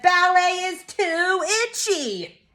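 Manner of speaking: high, slow, drawn-out whine of protest; loud childish complaint. A narrator's reading. Child complaining in ballet lesson, high mock-loud protest. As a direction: protest like a fed-up little kid. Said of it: "But I was very loud".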